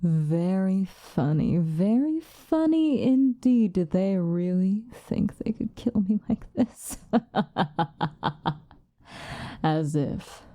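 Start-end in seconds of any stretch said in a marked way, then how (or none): 0.0-3.7 drawn-out, swooping mockery; villain mockingly amused; sardonic amusement. Mocking those who tried killing her. 7.0-8.5 long staccato scornful cackle; mocking villain's chuckle; villainous laugh. Scorning the failed attempt to kill.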